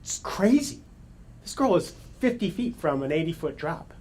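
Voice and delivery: high, urgent retold exclamation; alarmed exclaim. Narrator warning the laughing friends about the drop, high and urgent. Telling friends the girl's in danger.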